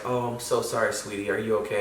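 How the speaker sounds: soft, low comforting murmur; tender consoling. Retold or imitated. Dad apologizes to his bawling daughter, tender and soft. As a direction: gently soothe a crying child, voice dropped.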